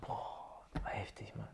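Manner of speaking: whispered amazement; hushed awe. Real, not acted. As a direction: breathe it out in awe. Awed hushed reaction.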